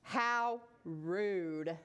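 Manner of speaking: low, indignant aside; mildly huffy and low; offended. Acted. Boy snubbed by a silent fish, mildly offended.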